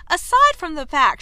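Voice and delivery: pointed scorn, lightly acted; scornful. A narrator's reading. Kate not done arguing with Anthony, scornful. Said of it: "she scorned".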